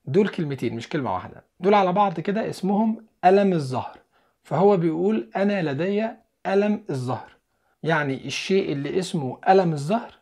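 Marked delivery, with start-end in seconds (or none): none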